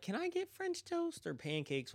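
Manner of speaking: storyteller voicing the woman customer; mock woman's voice. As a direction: voice the customer up high.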